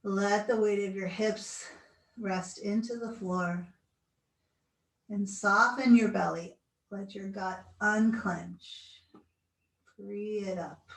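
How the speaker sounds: slow, soothing guided relaxation. Acted. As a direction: talk them gently into stillness. Leads final relaxation.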